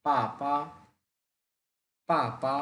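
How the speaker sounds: first পা short and falling, second পা light; পাপা with a falling first syllable; Mandarin tones. Shown as a demonstration. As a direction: snap the first পা down, the second পা neutral. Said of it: "short করে বলতে হবে".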